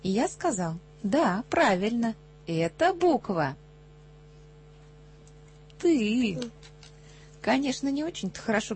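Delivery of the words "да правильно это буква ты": slow, loud, weighty announcement; deliberate confirmation. Acted. Tutor gives in and confirms the wrong letter, deliberately. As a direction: draw it out, pausing before the last word.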